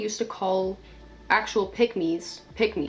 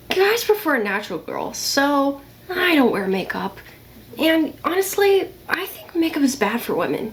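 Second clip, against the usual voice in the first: higher, put-on girly voice; mock pick-me girl; 5 semitones higher, 3 dB louder than usual. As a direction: play a self-satisfied pick-me girl. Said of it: "how a pick-me girl could act".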